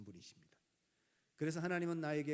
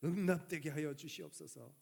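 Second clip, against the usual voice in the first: 기도하는 집사; 3 semitones higher, 5 dB louder than usual. Acted. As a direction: act out a deacon praying aloud. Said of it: "하나님 앞에 기도합니다". (하나님을 높고 크게 부르며 기도하듯)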